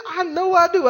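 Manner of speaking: high mock-female retort; insistent wife. Voicing the lady insisting.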